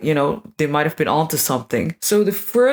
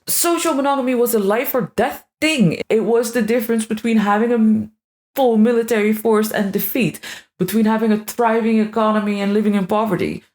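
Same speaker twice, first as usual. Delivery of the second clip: higher-pitched, dramatic proclamation; incredibly dramatic; 4 semitones higher than usual. Real, not acted. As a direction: build it up as a matter of life or death. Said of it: "this sounds incredibly dramatic".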